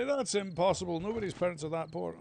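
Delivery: put-on teacher refusing to believe the boy. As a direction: scoff it like a teacher. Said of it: "And he'd say".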